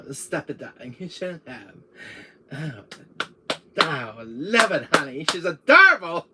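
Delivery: put-on gushing girly act swelling at the end; girl impression. Doing a girl impression for Chris, coy and gushing. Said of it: "Here's my girl impression for you".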